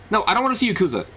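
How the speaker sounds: ordinary delivery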